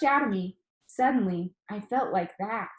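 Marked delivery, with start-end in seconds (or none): none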